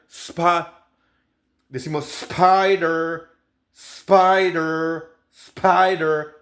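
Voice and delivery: "spider" with an English r at the end. Shown as a demonstration. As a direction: finish "spider" on a clear r. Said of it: "y R al final". ("spider" con R al final)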